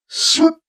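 high swooping whoosh sound; dart whoosh. A narrator's reading. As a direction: whoosh it sharply. Dart fired sound effect.

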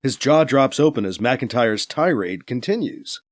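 ordinary delivery, nothing marked

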